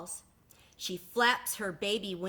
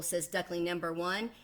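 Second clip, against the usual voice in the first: ordinary delivery, nothing marked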